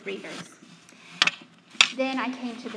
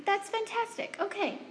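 much higher pitched, bright reaction; delighted surprise; 8 semitones higher than usual. Real, not acted. Reacting to audience answer.

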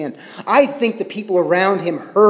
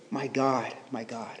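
soft lament; 4 semitones lower, 13 dB quieter than usual. Acted. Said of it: "especially in lament".

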